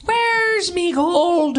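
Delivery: very slow, drawn-out Irish cry; mock Irish accent. Butting into the Irish contest as leprechaun, mock Irish accent. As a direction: stretch each word like a stage Irish leprechaun.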